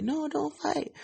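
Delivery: squeaky mocking imitation of a clingy drunk girlfriend. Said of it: "the drunk girlfriend thing".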